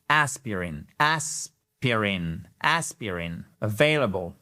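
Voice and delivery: aspirin drawn out very slowly; slow demonstration. Shown as a demonstration. Teacher slowing aspirin down for students. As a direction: spell out aspirin slowly for learners.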